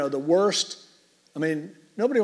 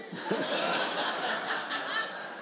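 breathless laugh; 11 semitones higher, 8 dB quieter than usual. Real, not acted. Congregation laughs at child's joke.

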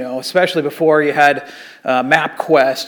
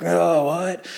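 lightly voiced groggy college surfer, softer; groggy waking surfer; 5 dB quieter than usual. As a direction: mumble it like a kid just woken up. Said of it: "he wakes up".

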